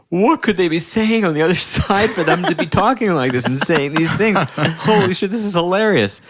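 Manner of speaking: put-on high, amazed teenage voice; a teenager marveling at overheard fights. Retold own teenage amazement.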